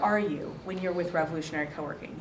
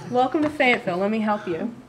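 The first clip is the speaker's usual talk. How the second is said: loud, lifted mock greeting; hammed-up hospitable greeting; projected welcome; 4 semitones higher, 7 dB louder, faster than usual. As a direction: call out a big welcome.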